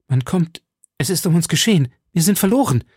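high, urgent alarm. A narrator's reading. Lady hearing someone come to the door, alarmed. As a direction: warn him in rising panic.

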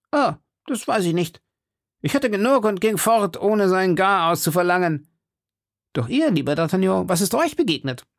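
high-pitched musketeer character voice; Porthos. Acted. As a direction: airily brush it off in a high voice. Porthos's voice in read story.

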